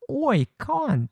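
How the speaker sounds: very high mock Australian accent; Australian accent attempt. Shown as a demonstration. Retrying after being called too British, Australian accent attempt. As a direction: try a squeakier fake Aussie. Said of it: "still a little bit British".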